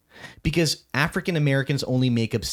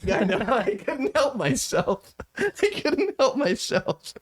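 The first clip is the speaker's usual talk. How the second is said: laughing through the words, slow and higher; 11 semitones higher, slower than usual. Real, not acted. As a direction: giggle it out sheepishly, slow. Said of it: "laughing while saying it".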